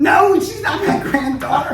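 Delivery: loud, high yell broken by laughter; laughing indignant shout at the shopkeeper; laughing mock yell. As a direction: shout the denial while cracking up. Retelling same yell at store owner.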